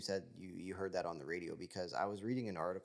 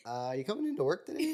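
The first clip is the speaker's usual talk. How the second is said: high, fast, teasing boss on the phone; mock boss phone call; 12 semitones higher, 7 dB louder, faster than usual. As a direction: call her in like a puzzled boss.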